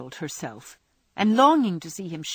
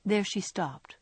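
ordinary delivery, nothing marked

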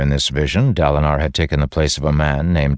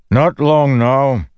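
high, loud, drawn-out call; shouting back; 10 semitones higher, 5 dB louder, slower than usual. A narrator's reading. Armored flyer answering his companion over the wind, shouting. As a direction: holler it back slowly across the wind. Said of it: "the man shouted back".

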